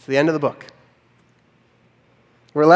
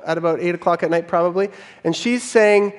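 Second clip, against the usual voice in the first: normal talking voice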